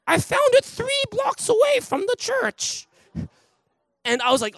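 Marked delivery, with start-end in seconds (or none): 0.0-2.9 put-on much higher, slow old lady voice; little old lady. Soaked old lady handing back the found wallet, high and slow. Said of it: "and she goes".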